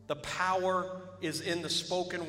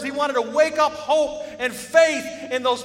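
loud and high, driving the point home; fiery preacher at full volume; full-force preaching; 7 semitones higher, 10 dB louder than usual. Acted. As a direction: preach it out to the whole church.

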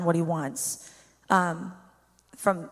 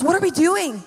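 high, loud urgent exclamation; alarmed outburst; 7 semitones higher, 6 dB louder than usual. Retold or imitated. Ethan realizing they ignored the hungry man, alarmed loud outburst. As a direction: retell Ethan's alarm at full pitch, much higher and louder.

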